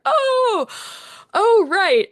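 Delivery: high, loud "oh" of sudden realization; funny shock. Retold or imitated. Composer realizing her Australian accent shows, comically shocked. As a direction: act out a comic jolt of surprise. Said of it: "a funny shock to me".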